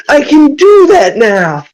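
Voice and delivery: high, drawn-out triumphant shout; excited shout. Acted. Player crows again about his new ability, excited shout. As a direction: stretch it out, crowing with excitement. Repeats it, bigger.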